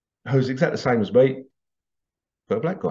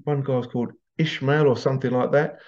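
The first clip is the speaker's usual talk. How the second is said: normal talking voice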